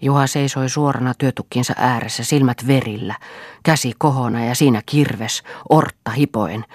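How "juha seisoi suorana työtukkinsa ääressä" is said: ordinary delivery, nothing marked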